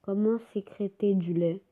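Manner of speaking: ordinary talk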